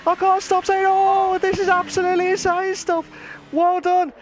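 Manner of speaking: normal talking voice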